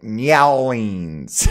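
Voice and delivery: "nyowlings" drawn out slowly as NYAH-leens; slow, loud silly "nyowlings" for New Orleans; funny pronunciation. Shown as a demonstration. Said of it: "this one I pronounce it".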